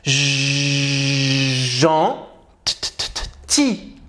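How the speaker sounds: teacher slowly taking apart gentil into sounds; syllable by syllable. Shown as a demonstration. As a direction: spell out gentil one sound at a time, ending on ti. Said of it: "regarde bien".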